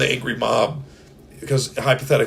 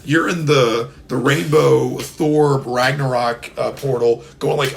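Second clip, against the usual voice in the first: ordinary talk, nothing marked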